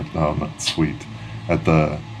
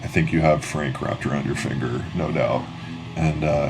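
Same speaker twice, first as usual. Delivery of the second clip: normal talking voice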